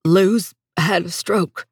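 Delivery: slow, halting grief; catch in voice. A narrator's reading. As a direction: break the news slowly, holding back tears. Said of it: "said with a catch in his voice".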